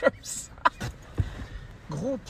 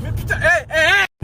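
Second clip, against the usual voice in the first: much higher, louder frantic yelling; panicked shouting; 9 semitones higher, 9 dB louder than usual. Acted. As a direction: shout in panic while driving. Driver shouting.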